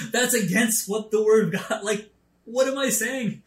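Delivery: sudden comic panic in his head; inner panic. Retold or imitated. Panicked thought after blurting words.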